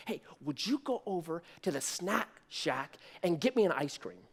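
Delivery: soft, low, secretive asking; imitating grandpa sneaking a favour; furtive request. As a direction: say it hushed so the girl won't hear. Grandpa furtively asks for ice cream.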